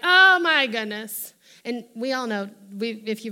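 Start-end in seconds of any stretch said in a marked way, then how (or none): 0.0-1.3 mock sulk. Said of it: "He doesn't even sulk like".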